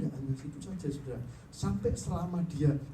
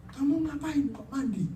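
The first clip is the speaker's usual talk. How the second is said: astonished; 10 semitones higher, 5 dB louder than usual. Retold or imitated. Wife astonished husband bathed.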